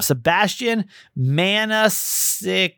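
name sounded out slowly, syllable by syllable; slow and deliberate on a tricky name; slow, careful pronunciation. Real, not acted. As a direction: draw out the name Maniscalco in careful pieces. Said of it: "I gotta say that slowly".

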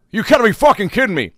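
high, loud exasperated outburst; angry customer. Retold or imitated. Angry customer in line at the Dairy Queen, high and loud. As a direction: blurt it like a fed-up customer. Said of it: "retold angry outburst".

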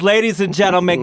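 "Ladies and gentlemen" as a pumped-up host build; hyped announcer intro. Real, not acted. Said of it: "host introducing guest".

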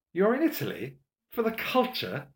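high-pitched mocking questions; high, incredulous echo; mock incredulity. A narrator's reading. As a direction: pitch it up in scornful disbelief. Lavelle mocks the culture tour.

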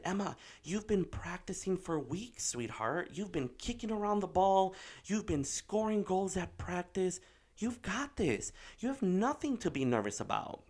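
high-pitched, insistent pep talk; retold encouragement. Dad reminding daughter of her practice, encouraging. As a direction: coax a nervous child with earnest reassurance.

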